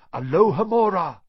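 usual speaking voice

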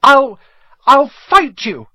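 loud, high, faltering threat; desperate challenge. A narrator's reading. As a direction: work up the nerve for a high-pitched challenge. Steeling himself to do something desperate.

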